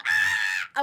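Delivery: deep startled ah; deep gasp of shock; low shocked groan. Retold or imitated. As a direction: drop low in alarm. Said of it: "so I'm like".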